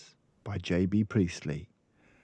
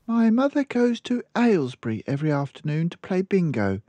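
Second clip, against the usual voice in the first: put-on much higher little girl voice; child explaining her mother's habits; small girl; 12 semitones higher, 5 dB louder, faster than usual. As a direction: speak like a matter-of-fact little girl. Small girl answers librarian.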